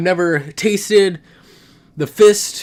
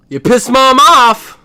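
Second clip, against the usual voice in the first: gleeful punchline; 9 semitones higher, 10 dB louder, slower than usual. Real, not acted. Gleeful punchline about mom's anger.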